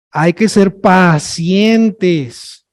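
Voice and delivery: very slow, each word drawn long; stretched out. Shown as a demonstration. Teacher demonstrating emphasis, drawn-out slow delivery. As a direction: drag the phrase out for emphasis. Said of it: "Lo alargo".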